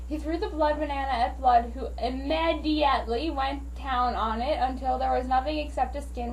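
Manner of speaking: plain reading voice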